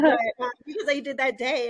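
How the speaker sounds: real laugh breaking through the line. Real, not acted. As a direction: laugh it out, moved and embarrassed. Laughing at own emotion.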